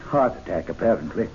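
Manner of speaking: low, soft and grave; hushed, heavy report of a death; somber news. Acted. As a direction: break the news gently.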